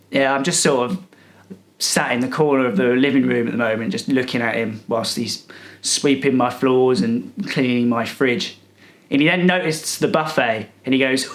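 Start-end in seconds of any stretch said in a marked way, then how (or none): none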